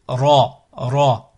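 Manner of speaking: Ra with a rolled Spanish-style R. Shown as a demonstration. Teacher showing the Arabic Ra, R rolled as in Spanish. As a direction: roll the R at the start of Ra. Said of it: "On enroule, comme le, en espagnol".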